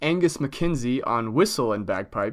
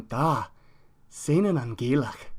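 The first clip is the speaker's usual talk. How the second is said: put-on lightly Scottish accent; slower than usual. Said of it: "in a Scottish accent".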